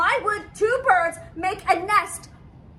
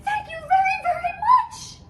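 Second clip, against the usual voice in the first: overjoyed thanks; 11 semitones higher than usual. Acted. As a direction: gush thanks to Piggy, tearful with joy. Grateful relief to Piggy.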